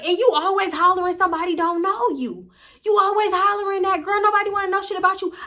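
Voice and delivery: high, loud accusing yell; angry yelling. Retold or imitated. Unstable yelling at her.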